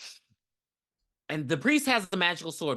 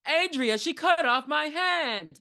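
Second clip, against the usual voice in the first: high, slow, whining complaint; whiny victim; 11 semitones higher, slower than usual. Retold or imitated. Man complaining his hand was cut off, mock high whine. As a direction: wail it like a hammed-up movie victim. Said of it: "that's another favorite line".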